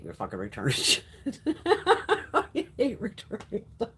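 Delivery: words breaking up into laughs; laughing. Real, not acted. Telling Paul about hating returns, laughing. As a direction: talk through laughter.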